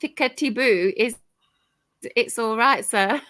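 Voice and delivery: usual speaking voice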